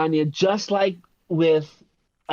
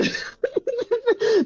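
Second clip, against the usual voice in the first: high-pitched squeal of laughter; helpless laugh. Real, not acted. Podcast guest losing it mid-point, high laughter.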